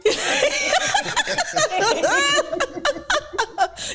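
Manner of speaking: high burst of real laughter. Real, not acted. Host bursts out laughing after the guest teases her. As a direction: crack up, caught out.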